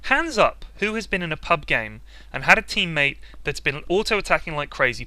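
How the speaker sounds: ordinary talk, nothing marked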